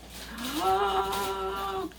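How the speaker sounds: long, high dramatic gasp. Acted. Storyteller acting the little chair giving way, shocked gasp. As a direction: gasp theatrically, high and drawn out.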